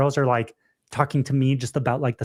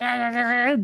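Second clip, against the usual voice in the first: high imitated crying noise; exaggerated blubbering wail, played for laughs; mock bawling wail; 9 semitones higher than usual. Imitating American chick bawling in lady's face, mock wail. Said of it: "starts bawling in her face and is like".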